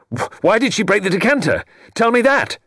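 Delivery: stammer, then hurl the demand; frantic cry. Acted. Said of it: "he cried".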